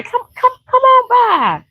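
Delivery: high, eager hostess voice; warm welcome call. Retold or imitated. Imitating the neighbor welcoming the Nichols, high. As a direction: beckon the guests in, all delight. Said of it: "they just welcomed me right".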